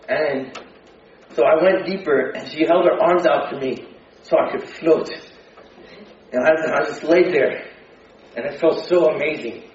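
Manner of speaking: ordinary delivery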